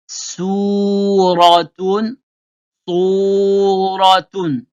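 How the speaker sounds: "Suratun" drawn out very slowly, syllable by syllable; teacher modelling "Suratun" very slowly for pupils to repeat; "Suratun" stretched out, each sound clear; Arabic pronunciation model. Shown as a demonstration. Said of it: "Ah, vous écoutez bien, faire la différence".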